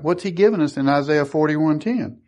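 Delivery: ordinary talk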